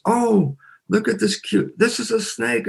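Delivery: high, gentle show-and-tell voice; delighted mother. Retold or imitated. Biologist mother showing her baby a snake, delighted. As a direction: coo over it in a high voice.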